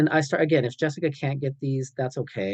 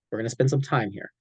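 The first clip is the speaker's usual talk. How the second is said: ordinary talk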